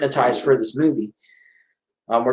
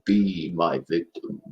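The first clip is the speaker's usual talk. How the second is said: low, slow "be my victim" rumbled deep; Candyman impression; 9 semitones lower, 7 dB quieter, slower than usual. Fan retries the villain's line, deep and slow. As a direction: stretch it out slow and menacing like the killer. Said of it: "I can't do it".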